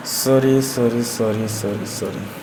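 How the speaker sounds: low, repeated sorry dying away; fading apology. Real, not acted. Mutters apology over a slip.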